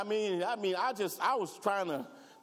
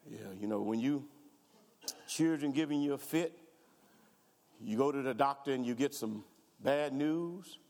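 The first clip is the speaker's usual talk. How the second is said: usual speaking voice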